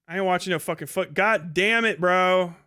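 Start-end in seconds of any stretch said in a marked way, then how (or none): none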